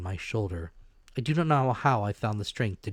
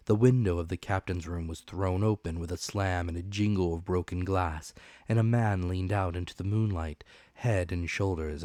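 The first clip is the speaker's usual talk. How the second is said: ordinary delivery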